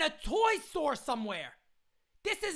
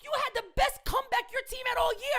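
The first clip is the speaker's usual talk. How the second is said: normal talking voice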